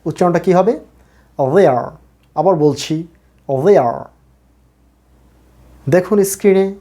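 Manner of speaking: usual speaking voice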